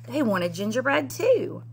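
ordinary delivery

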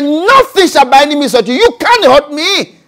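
high, loud defiant declaration; teaching the church to defy Satan; bold declaration. Shown as a demonstration. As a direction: declare it to the devil's face. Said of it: "Say that".

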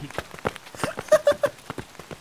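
high airy giggle; breathy laugh. Real, not acted. Laughs at Yankee mixup.

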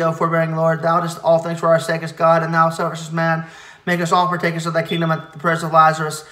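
ordinary delivery

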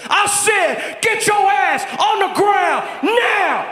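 high, loud bank robber's command; authoritative shout. Acted. Armed robber ordering a bank to the floor, shouting. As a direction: shout it with full authority, guns blazing. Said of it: "you need authority".

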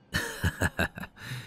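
a short, quiet amused chuckle; soft chuckle. Acted. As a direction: chuckle softly, pleased with yourself. Showing off his car, amused.

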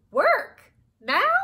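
loud, high astonished questions; startled disbelief. Acted. Miss B hearing there is work now, startled. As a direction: squawk each word as a shocked question.

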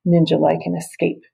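ordinary delivery, nothing marked

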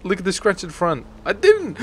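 mock whimpering excuses; whiny pleading apology. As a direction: beg forgiveness like a cornered valet. Begging customer after scratching car.

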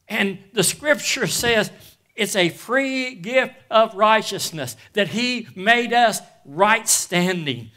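loud, high preaching, building in force; full-force preaching. Acted. Preacher proclaiming to the church, loud and high. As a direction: preach it out to the congregation.

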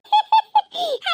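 high teasing laugh at her; high mocking cackle. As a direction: laugh at the neighbour's stunned face. Mocking the frightened neighbour.

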